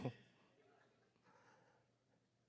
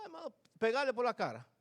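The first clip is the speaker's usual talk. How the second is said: normal talking voice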